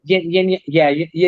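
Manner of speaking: usual speaking voice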